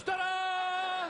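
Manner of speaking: high, drawn-out "ta-da"; long, high fanfare; showman's ta-da. Acted. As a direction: present yourself like a circus act, voice way up. Mimes parading onlookers through bedroom.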